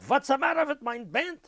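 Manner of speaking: high mock Yiddish-accented complaint; imitating an old bandleader; accented bandleader yell. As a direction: whine it like the flustered old leader. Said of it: "The leader is yelling out".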